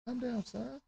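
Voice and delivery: real off-mic calming; hushed parental correction. Real, not acted. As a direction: drop right down to settle your son. Settling his noisy child mid-stream.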